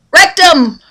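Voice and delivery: high loud Rectum for wreck 'em; mock child mangling the cheer; kid's misheard holler. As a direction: yell Rectum like a toddler. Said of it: "hollering really, really loud".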